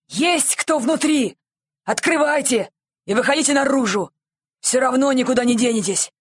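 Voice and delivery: calling out commandingly. A narrator's reading. Policeman ordering whoever is inside out, calling loudly. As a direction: call through a shutter with authority.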